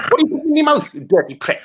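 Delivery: squeaky high, scolding mum voice; nagging mum. Acted. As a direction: scold like a disgusted mum, pitch jumping up. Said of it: "Sound like my mum right now".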